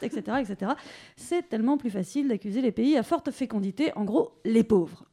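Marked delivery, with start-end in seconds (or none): none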